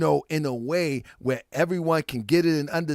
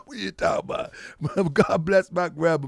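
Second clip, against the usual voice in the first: normal talking voice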